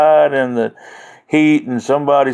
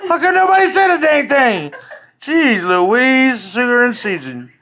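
much higher, laughing complaint; half-laughing, mock outraged; laughing exasperation; 9 semitones higher than usual. Real, not acted. To viewers who never mentioned the echo, laughing exasperation.

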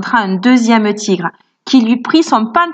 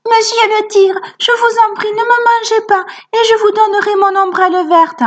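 voix d'enfant très aiguë qui supplie; petit garçon suppliant; 11 semitones higher than usual. A narrator's reading. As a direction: implore le tigre d'une voix haute. Sambo supplie le tigre. (le petit garçon supplie le tigre, voix très aiguë)

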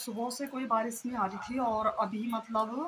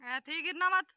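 calling out; 7 semitones higher, 5 dB louder than usual. Real, not acted. Warning child from distance.

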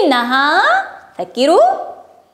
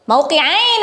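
ordinary delivery

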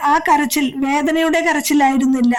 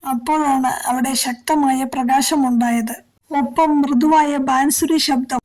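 usual speaking voice

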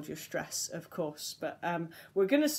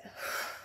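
soft voiceless release of breath; breathy sigh out; 9 dB quieter than usual. Shown as a demonstration. Coach showing the class the release, breathy sigh out. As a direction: let the breath fall out with no voice. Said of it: "And then let it".

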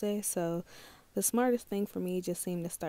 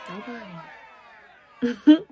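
drawn-out loud wail; 10 dB louder, much slower than usual. Acted. Complaining nobody asked her out.

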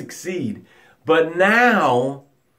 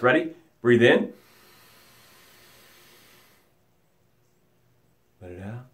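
guided breathing; 5 semitones lower, 3 dB quieter than usual. Acted. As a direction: guide the breaths into calm stillness. Leads breathing exercise.

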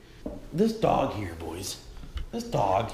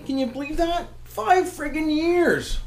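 high, incredulous exclamation, drawn out at the end; amazed; 12 semitones higher than usual. Real, not acted. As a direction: marvel in a high, stretched voice. Marvels at how long fish lived.

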